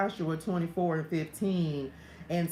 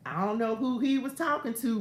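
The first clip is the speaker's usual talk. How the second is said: normal talking voice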